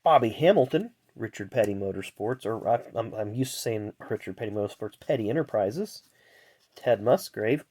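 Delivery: ordinary talk, nothing marked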